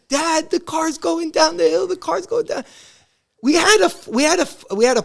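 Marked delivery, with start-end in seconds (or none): 0.1-2.6 retold scream. Running to warn his dad, mild mock scream. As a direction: squeal the alarm like a scared kid. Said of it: "I run to the backyard screaming".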